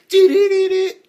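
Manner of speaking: loud, high rapid-syllable sound effect; imitated weapon noise. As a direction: blast out a high mock summoning sound.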